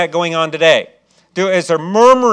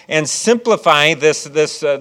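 ordinary talk, nothing marked

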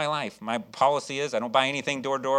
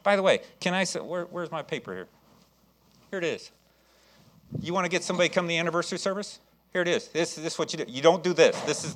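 ordinary talk, nothing marked

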